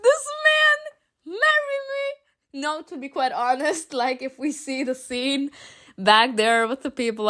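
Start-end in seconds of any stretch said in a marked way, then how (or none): 0.0-2.6 swooning plea. Real, not acted. Swooning over the angel.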